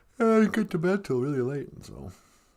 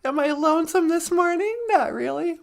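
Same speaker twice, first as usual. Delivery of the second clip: much higher comic character voice; high puppet voice; 13 semitones higher than usual. Pretend. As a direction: answer as a squeaky puppet.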